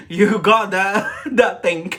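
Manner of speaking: slow, loud, laughing tease. Real, not acted. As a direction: laugh it out slowly.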